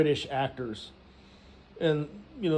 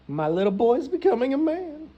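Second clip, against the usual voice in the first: high mock-motherly gushing; doting mom; 9 semitones higher than usual. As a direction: coo over your little boy in a high voice. Acts out mother proud of son.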